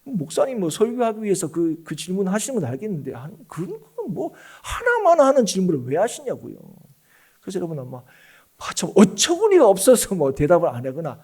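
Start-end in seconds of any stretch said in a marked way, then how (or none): 0.0-6.6 imitated listener complaint, high and exasperated; acted annoyance at a pointless question; irritated protest. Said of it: "막 이렇게 화를 내면서 대답하실 수도". 8.6-11.2 acted exasperation, building to a high, loud retort; mock outrage at being asked the obvious; angry answer. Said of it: "막 이렇게 화를 내면서 대답하실 수도".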